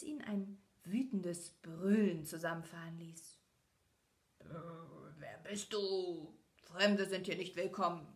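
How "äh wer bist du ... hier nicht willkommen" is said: a beast in the shadows warns off the stranger; growling beast. Acted. The Beast's voice from the dark.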